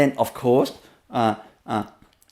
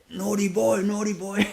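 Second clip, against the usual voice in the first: scolding lady; 4 semitones higher, 5 dB quieter than usual. Retold or imitated. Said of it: "like this".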